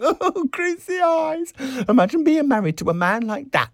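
high-pitched hilarious squeal; crying out. A narrator's reading. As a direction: squeal it in gleeful disgust. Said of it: "she cried".

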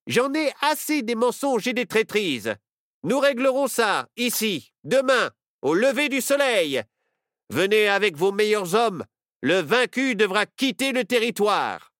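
sheriff shouting. Acted. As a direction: yell over the brawl to lay down the challenge. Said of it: "Le shérif cria alors, pour couvrir le bruit". (le shérif crie pour couvrir le bruit, autoritaire)

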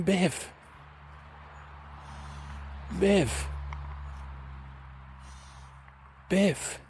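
goose call. Acted. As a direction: call the name like a far-apart bird cry. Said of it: "hear the call of the giddy geese".

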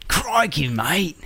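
mock Aussie, high on crikey; a quick hammed-up Aussie cry; Australian accent. Cohost teases with a mock Australian accent. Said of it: "They're basically the same".

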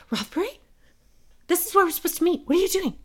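high, softly voiced confusion; retold bewildered woman. Woman finding him where they were supposed to meet, bewildered.

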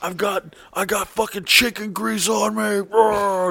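high panicky mock wail; freaking out. Waiter panicking backstage over chicken grease, high squeal. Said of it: "freaking out".

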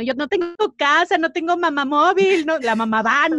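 lamento exagerado, agudo y fuerte; quéjate como si lloraras, en plan teatral; llanto imitado. Retold or imitated. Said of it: "yo lloraba, ¿no?". (lloriqueo dramático fingido)

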